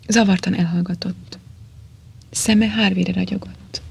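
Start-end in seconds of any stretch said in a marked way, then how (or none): none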